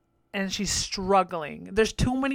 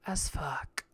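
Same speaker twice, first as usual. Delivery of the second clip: whispered tag; 5 semitones lower, 8 dB quieter than usual. Retold or imitated. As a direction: drop to a whisper. Whispered punchline.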